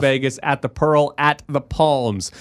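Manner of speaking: hyped giveaway announcer read; radio contest promo. Acted. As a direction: sell the ticket contest.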